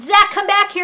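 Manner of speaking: exasperated mock shout; retold yell after brother. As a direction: act out calling a runaway kid back. Sister yelling after brother.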